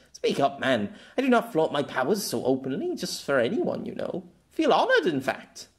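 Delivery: lofty and a little smug; haughty young girl. Acted. Girl teasing Pierre about her powers, proud and light.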